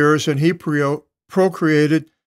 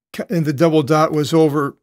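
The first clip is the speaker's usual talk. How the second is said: normal talking voice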